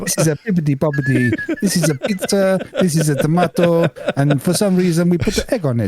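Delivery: put-on a cheesy Italian accent for a laugh; mock Italian. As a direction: play an Italian chef, badly. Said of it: "how do you do Italian?".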